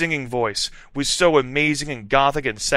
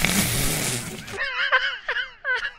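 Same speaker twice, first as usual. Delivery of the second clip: laughing fit; 4 dB quieter than usual. Real, not acted. Narrator cracks up reading.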